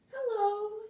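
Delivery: high sweet flower greeting. Acted. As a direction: sing out a high hello. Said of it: "say the flowers".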